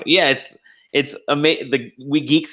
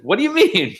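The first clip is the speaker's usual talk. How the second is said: high-pitched incredulous question; imitated astonishment; 12 semitones higher than usual. Director hears he has known those guys for years, imitated astonishment. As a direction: act out the director's disbelief.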